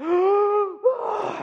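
loud gasp after holding breath; big gasp for air. Retold or imitated. Retelling the pastor surfacing from the pool with a gasp. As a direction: gulp air like you just surfaced. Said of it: "he's just like".

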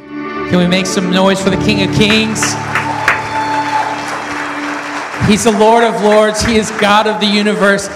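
loud hype-man worship call; rally speech. Acted. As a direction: fire up the crowd, full voice. Hyping crowd before sermon.

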